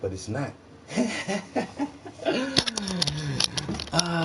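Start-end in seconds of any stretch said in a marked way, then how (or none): 0.9-3.9 a long soft breathy laugh. Real, not acted. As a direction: laugh quietly at yourself. Laughing at fooled self.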